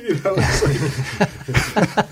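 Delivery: giggling through the words; laughing. Real, not acted. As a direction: laugh while you talk. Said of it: "laughing after the impression".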